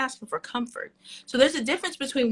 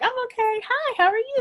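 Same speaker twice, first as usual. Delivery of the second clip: much higher, put-on chirpy greeting; fake cheerful front; 12 semitones higher than usual. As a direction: play the bubbly friend hiding how she feels. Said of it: "keep being like".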